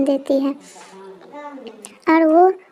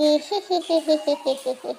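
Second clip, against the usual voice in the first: witch cackle. Acted.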